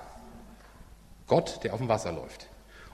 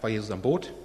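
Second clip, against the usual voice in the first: usual speaking voice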